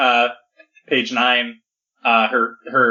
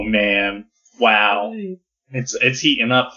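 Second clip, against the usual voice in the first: ordinary talk, nothing marked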